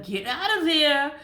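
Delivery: yelling at herself to stay out of it; sharp self-scolding. Shown as a demonstration. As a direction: shout yourself out of it. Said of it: "I have to tell that to myself".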